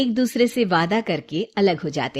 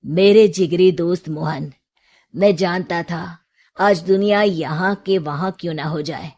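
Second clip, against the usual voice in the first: a lightly voiced friend, lower and sorrowful; Kishan's letter; 4 semitones lower than usual. Kishan writing sadly to the friend he arrested. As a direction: read the letter as a regretful old friend. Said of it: "जिसमें लिखा होता है".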